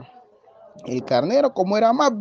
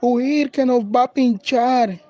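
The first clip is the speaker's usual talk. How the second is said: high, drawn-out alarm cry; frightened goats; 6 semitones higher, slower than usual. A narrator's reading. Goats startled by a big needle, crying out in alarm. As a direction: cry out to flee in storybook fright. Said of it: "Se asustaron y decían".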